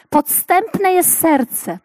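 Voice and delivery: slow, lifted reading of the verse; solemn scripture reading. Acted. Preacher reading Jeremiah to the women, solemn and slow. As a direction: intone it like holy writ. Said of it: "W Jeremiasza ... czytamy".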